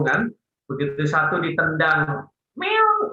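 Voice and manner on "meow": high-pitched imitation meow; cat meow. Trainer telling a joke about someone hiding in a sack, high fake meow. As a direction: mimic a kicked cat. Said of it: "oh kucing gitu kan".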